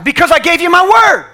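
God rebuking the doubting prophet; loud divine rebuke. Acted. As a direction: remind him firmly. God answering loudly.

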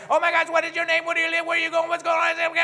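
high and loud, gushing with no pause for breath; frantic rapid-fire chatter. Retold or imitated. Preacher mocking someone who prays to God at breakneck speed, high and loud. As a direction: rattle off greetings like a hyper stranger. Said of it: "at this speed".

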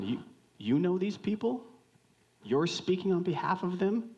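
high, rising, wondering questions; surprised questioning. Acted. God the Father asks his son, surprised. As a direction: ask as if just finding out, voice climbing.

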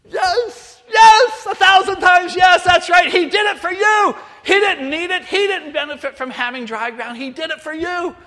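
high, loud cry of triumph; jubilant shout. Retold or imitated. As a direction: shout it overjoyed at the right answer. Overjoyed teacher hears right answer.

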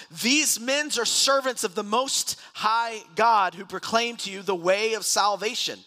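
high, loud, slow proclamation; drawn-out public cry in a Bible reading. A narrator's reading. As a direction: call it out over a crowd. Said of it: "crying out".

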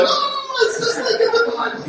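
very high squealing imitation, half laughing; giddy falsetto mock shriek; laughing video guy. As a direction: squeal it giddily like a thrilled man fleeing. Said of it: "they're laughing, just laughing the whole time".

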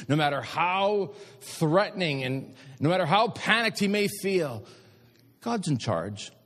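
building to high, drawn-out proclamation; impassioned preaching. Real, not acted. Preacher assures congregation God is sovereign, building fervently. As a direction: swell steadily into a ringing declaration.

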